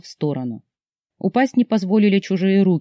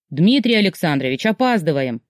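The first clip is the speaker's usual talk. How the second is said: usual speaking voice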